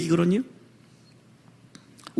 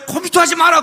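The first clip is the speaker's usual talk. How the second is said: higher, louder scolding mother; 7 semitones higher, 4 dB louder than usual. Retold or imitated. Mother scolding her child off the computer, higher. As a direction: snap at the child to stop. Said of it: "공부하는 애 특징이 뭐예요".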